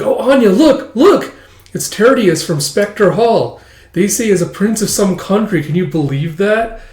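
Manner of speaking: high-pitched, excited schoolgirl; excited gushing. Acted. Connie points out the prince to Anya, excited gushing.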